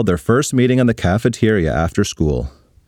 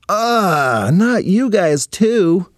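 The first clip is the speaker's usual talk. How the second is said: exasperated; 9 semitones higher, much slower than usual. Acted. Annoyed teen groans at parents.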